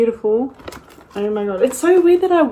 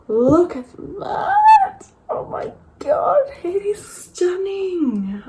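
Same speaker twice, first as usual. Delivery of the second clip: high, slow, awed; drawn out in wonder; breathy high gasp of awe; awed delight; 9 semitones higher, much slower than usual. Real, not acted. Awed at the palette.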